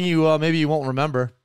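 ordinary talk

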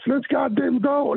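high-pitched mock rallying cry, retold; rallying yell. Retelling rallying the team.

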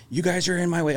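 a touch of road rage; mock angry complaint. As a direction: imitate the irritated driver, lightly. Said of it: "his tone started to go down".

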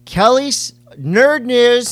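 high, loud, slow show intro; announcer voice. Pretend. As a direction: boom it out like a segment jingle.

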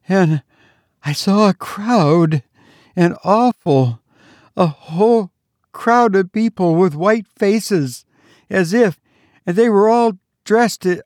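put-on high, gasping, frightened girl; breathless. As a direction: pant out the story in fear. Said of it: "speak in a breathless voice".

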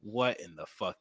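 low, soft shocked mutter; quiet disbelief. Retold or imitated. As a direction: say it under your breath, stunned. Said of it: "you're just like".

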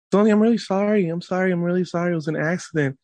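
put-on high, pleading child voice; imitated apologetic son. Son to crying sister after accident, imitated high pleading. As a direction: mimic a kid apologising, pitched well up.

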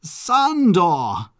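loud, high call of a name; excited cry. Acted. Man crying out to his cousin the Count, loud high call. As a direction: cry out across the room. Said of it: "came a cry".

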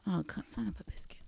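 hushed muttered curse; whispering. Real, not acted. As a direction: whisper it under your breath. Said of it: "sorry, game thing".

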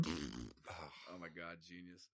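slow, low and very soft sarcastic awe; mock awe. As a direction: swoon over it sarcastically. Mock awe at radio music.

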